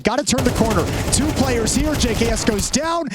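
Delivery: rising excited esports call; excited play-by-play. Acted. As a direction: call the action hyped.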